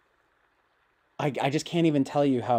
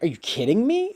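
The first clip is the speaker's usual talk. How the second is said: high mock outrage; a quick impression of a friend's disbelief; imitating Charles Carroll; 10 semitones higher, 4 dB louder than usual. Mimicking a friend he admires, high incredulous. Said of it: "something Charles Carroll says a lot will be like".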